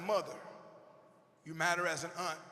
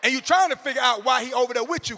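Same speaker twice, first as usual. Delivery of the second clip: ordinary talk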